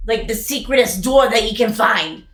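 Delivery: usual speaking voice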